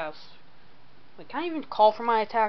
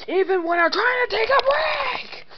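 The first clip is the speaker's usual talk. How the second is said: high angry shout; slower than usual. Acted. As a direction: explode into an attack. Character shouts, attacks.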